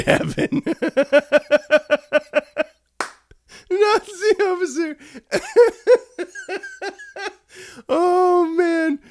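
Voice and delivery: squeaky, breaking through laughter; can barely get it out for laughing; laughing hard. Real, not acted. Reading a chat joke aloud, helpless laughter.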